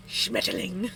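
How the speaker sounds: playful hint of an evil voice; lightly villainous German word. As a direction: put a touch of evil in it. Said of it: "the evil voice when I say Schmetterling".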